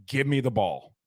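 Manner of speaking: ordinary talk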